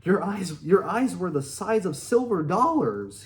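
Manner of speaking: loud, high teasing shout. Retold or imitated. Justin teases his friend about his eyes, loud shout. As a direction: yell it across at your buddy. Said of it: "he shouts".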